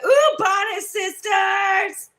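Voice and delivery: high, slow, shouted ooh bonnet sisters; shouted hammed-up "Ooh, bonnet sisters"; hammed-up cheer. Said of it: "I got up and I said".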